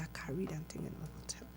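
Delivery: fast hushed whisper; a quick secret whisper to a cellmate. Retold or imitated. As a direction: breathe it out fast so no one hears.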